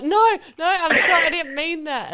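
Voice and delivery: loud, high mock-panicked pleading; frantic backpedalling. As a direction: act out desperately taking it back. Retold frantic denial to the sheriff.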